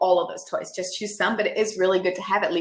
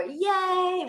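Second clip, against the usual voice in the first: a high, happy yay; little celebration cheer; 12 semitones higher than usual. Shown as a demonstration. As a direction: cheer it while clapping for the child. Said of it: "with clapping".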